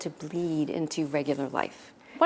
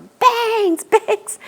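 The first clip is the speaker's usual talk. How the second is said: high, very loud imitated scream; screaming agent; 13 dB louder than usual. Agent running across the room at a model, screaming. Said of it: "came running across the room screaming at her".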